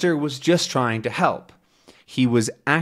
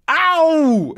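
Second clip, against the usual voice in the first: sharp high yelp of pain, loud; 5 dB louder than usual. Acted. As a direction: yelp as the dog bites. Man bitten by Snowy.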